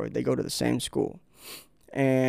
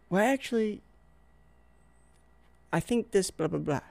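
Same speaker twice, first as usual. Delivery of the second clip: know-it-all; 6 semitones higher, 4 dB quieter than usual. Retold or imitated. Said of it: "They say".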